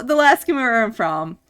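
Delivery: ordinary talk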